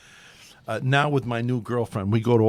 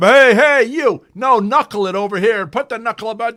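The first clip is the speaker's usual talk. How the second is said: high, loud yell across the field; coach yelling; 12 semitones higher, 13 dB louder than usual. Retold or imitated. Lasorda yelling pitching tips at a player, loud and high. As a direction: bark the pitching tip at him.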